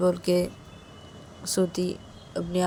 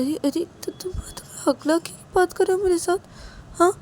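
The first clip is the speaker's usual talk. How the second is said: crying shock; 11 semitones higher, 3 dB louder than usual. A narrator's reading. Cries at stammering talk.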